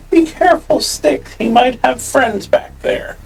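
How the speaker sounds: very high-pitched character voice; high put-on voice, calling a caution; in-character warning. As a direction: warn a companion in a high little voice. Player voices character's warning to companion.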